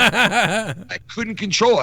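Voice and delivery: talking through real laughter; can't stop laughing as you speak. Real, not acted. Telling friends about the store, laughing helplessly.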